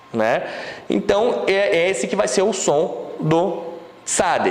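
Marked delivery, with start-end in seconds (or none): none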